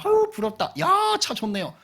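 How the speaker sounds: very high-pitched cooing envy; acted envious admiration. Admiring the neighbour's car, acted envious admiration. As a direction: gush over it in a high voice.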